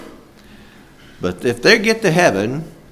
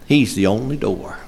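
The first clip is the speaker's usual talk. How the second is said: ordinary talk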